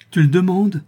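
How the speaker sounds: high, loud wounded outburst; anguished cry. A narrator's reading. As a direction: cry it out, wrenched from a tortured heart. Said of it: "s'écria Gabrielle". (cri déchirant, voix haute et forte)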